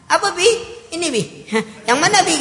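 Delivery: put-on a child daring his father to hit; mock child, half-teasing; cheeky child. Beaten child offering himself to his father.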